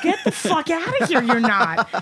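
high, loud, laughing scoff; laughing at a New Orleans local's claim; laughing disbelief. Retold or imitated. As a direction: scoff it away through laughter. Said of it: "And you're like".

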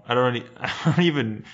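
normal talking voice

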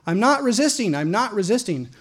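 high mock yell, easing on the repeat; retold scream. Man being jumped by Marines, mock scream. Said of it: "I'm screaming".

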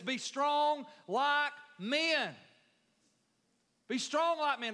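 high, loud, slow pulpit cry; full-force preaching. Acted. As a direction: drive the phrase home to the church.

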